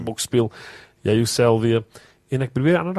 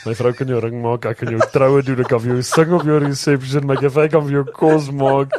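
infomercial voice; 4 semitones higher, 6 dB louder than usual. Pretend. Mock Fiverr advert pitch.

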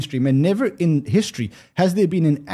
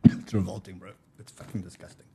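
disgusted; 9 semitones lower, 9 dB quieter than usual. Real, not acted. Reacting right after the vodka shot.

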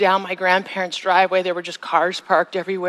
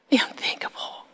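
soft unvoiced whisper; 7 dB quieter than usual. Preacher echoing the dread to the congregation, whispered. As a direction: breathe it out as a whisper. Repeated phrase dropped to whisper.

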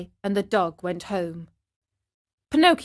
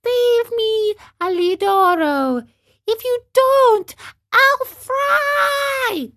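much higher, piercing frightened cry; pitiful cry; 14 semitones higher, 5 dB louder, slower than usual. Acted. Pinocchio begging the dog to save him, pitiful cry. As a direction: scream for help in terror. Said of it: "a pitiful little voice".

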